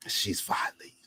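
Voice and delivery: hushed, breathy repeat under his breath; whispered. Retold or imitated. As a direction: hiss it under your breath.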